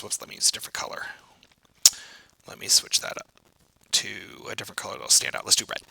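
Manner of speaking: quick unvoiced whisper to himself; muttered aside. Real, not acted. Teacher switching marker colour, whispering to himself. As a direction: whisper it off to the side.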